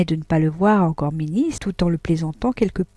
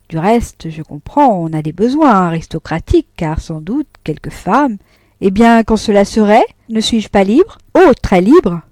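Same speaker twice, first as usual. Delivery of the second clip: bitter sarcasm; 2 semitones higher, 7 dB louder than usual. Acted. Deslauriers' bitter reproach.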